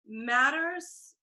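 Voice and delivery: ordinary talk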